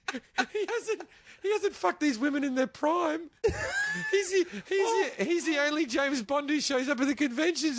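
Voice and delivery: high, breaking into laughter; words pushed through giggles; laughing while telling it. Real, not acted. Cracking up mid-story.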